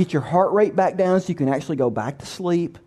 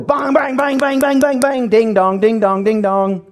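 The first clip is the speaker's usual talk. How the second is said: rapid-fire raised-pitch bangs, then sing-song ding-dongs; imitated knocking; 4 semitones higher than usual. Neighbor pounding on the door at two A M, frantic imitation. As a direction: mimic frantic door banging and bell ringing. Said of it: "hear this loud knock at your door".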